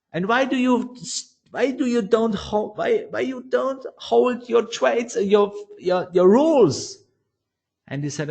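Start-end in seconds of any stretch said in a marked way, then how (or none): 0.1-7.0 high-pitched, exasperated scolding; a retold, mildly exasperated telling-off. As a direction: raise the pitch in disbelief at his mistakes. Scolding the trader on the phone.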